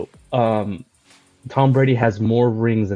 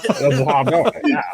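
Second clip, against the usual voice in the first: Kermit the Frog voice; 3 semitones higher than usual. Retold or imitated. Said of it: "Kermit the Frog impression".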